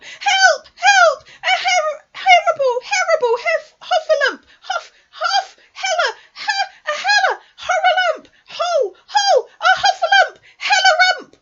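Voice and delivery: garbled, very high loud squealing; panicked Piglet. A narrator's reading. Piglet scampering away from the Heffalump, frantic high shrieking. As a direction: shriek in terror, tripping over the words. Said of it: "still crying out".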